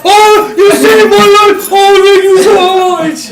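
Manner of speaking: high, loud, drawn-out wail of thanks; hammed-up gushing relief; overjoyed rescued man. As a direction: imitate wailing gratitude, slow and loud. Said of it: "Phil just jumps up".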